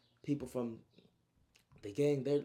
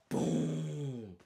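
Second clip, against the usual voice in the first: higher, long rumbling boom; explosive blast noise; 4 semitones higher, 4 dB louder than usual. Retold or imitated. Storyteller imitating the water beam hitting big homie, long resounding boom. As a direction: sound out a huge blast.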